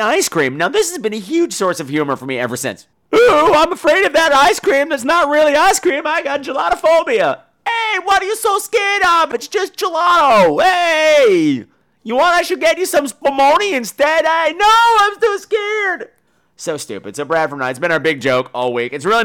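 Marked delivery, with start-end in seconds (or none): 3.1-7.4 high put-on whine of silly dread; hammed-up fearful character voice, pitched way up; mock scared sufferer. Joking as someone afraid of ice cream, mock scared. 7.7-14.3 hammed-up teasing shout at a scaredy-cat; mock incredulous pal. Pal offering spumoni to the gelato phobic, mock incredulous. Second character teases the phobic. 14.3-16.1 very high hammed-up wail; mock scared sufferer. Phobic refusing spumoni from a pal, mock scared wail.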